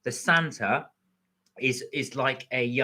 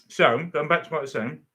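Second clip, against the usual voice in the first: mouth full; 2 semitones higher, 3 dB louder than usual. Real, not acted. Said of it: "Sorry, with my mouth full".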